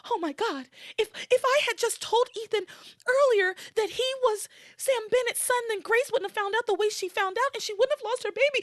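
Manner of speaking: high, dawning-horror melodrama; distraught Teresa. Acted. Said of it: "Teresa has this realization".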